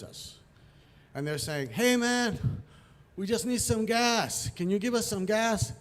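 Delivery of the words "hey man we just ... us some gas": punk calling over, higher and drawn out. Retold or imitated. Punk asks tow truck driver for fuel, calling out. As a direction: stretch it out, pitched up, to hail someone.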